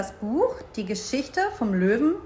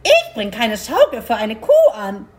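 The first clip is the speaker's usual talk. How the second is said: indignant, loud squawking crow; full-volume crow protest; loud crow refusal; 8 dB louder than usual. Acted. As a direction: refuse loudly as an outraged crow. Crow refuses cow's request loudly.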